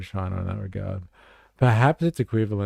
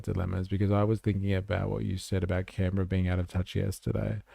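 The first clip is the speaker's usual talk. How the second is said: normal talking voice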